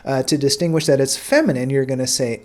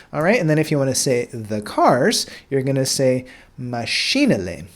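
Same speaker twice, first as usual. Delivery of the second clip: ordinary delivery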